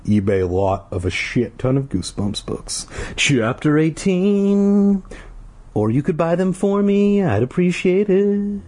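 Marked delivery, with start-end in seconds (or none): 3.2-5.0 put-on high, slow creepy kid; reader's creepy kid voice, high and slow; Jelly Boy voice. Jelly Boy voice announces chapter. 5.7-8.6 put-on high creepy kid asking nicely; Jelly Boy asking listeners to buy books.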